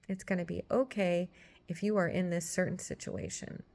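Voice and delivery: normal talking voice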